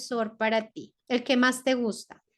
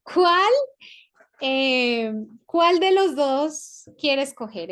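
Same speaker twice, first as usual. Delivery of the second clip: slow, louder dictation voice; 2 semitones higher, 5 dB louder, much slower than usual. Shown as a demonstration. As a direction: dictate it slowly for students to write. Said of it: "dictating slowly to class". (dictado lento y alto)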